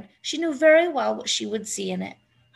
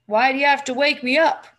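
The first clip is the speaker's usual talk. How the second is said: sighing complaint; 4 semitones higher, 5 dB louder than usual. A narrator's reading. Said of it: "he sighed".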